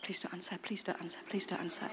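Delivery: very soft, pleading under breath; whispered dread; nervous muttered plea. Retold or imitated. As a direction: beg quietly the phone isn't answered. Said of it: "I rang her like this".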